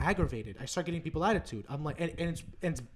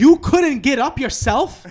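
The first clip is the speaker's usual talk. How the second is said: indignant retort; 8 semitones higher, 15 dB louder, slower than usual. Retold or imitated. Retorting at parents' errand.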